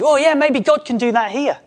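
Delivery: high, eager and lifted; excited hope. Retold or imitated. Congregation member hoping God moves here, high excited voice. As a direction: voice a hopeful believer, high and eager. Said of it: "we could get excited".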